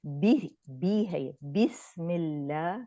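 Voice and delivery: بی said with a short i, slow and careful; correct short i. Shown as a demonstration. Teacher showing the correct بسم اللہ, slowly. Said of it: "بڑی یہ کی sound".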